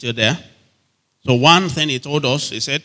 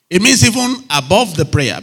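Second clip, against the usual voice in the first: ordinary talk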